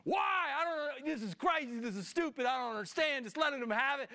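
high, exasperated venting at God; re-enacted frustrated rant; having it out. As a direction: burst out high on the first word, then rant on. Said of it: "Great place to just have it out with God".

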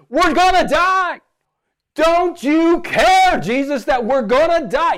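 high, loud frightened cry of the disciples; panicked disciples. Retold or imitated. Disciples crying out to Jesus in the storm, high and frightened. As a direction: shout it like men sure they will drown. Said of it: "They say".

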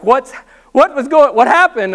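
alarmed question. Retold or imitated. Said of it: "they're like".

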